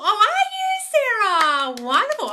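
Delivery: high, loud, very drawn-out delight; swooping, stretched-out congratulations; delighted gushing. Real, not acted. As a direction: gush over a friend's good news. Gushes at viewer's promotion news.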